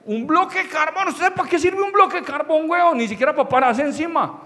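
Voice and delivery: high-pitched comic outrage; hammed-up indignation; indignant rant. Indignant rant about the coal.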